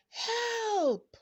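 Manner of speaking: high call echoing in a cave; yelling for help. A narrator's reading. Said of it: "she yelled".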